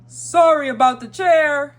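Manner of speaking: loud, high call from far away; Santa Claus. A narrator's reading. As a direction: call out an apology at full voice. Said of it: "Santa called".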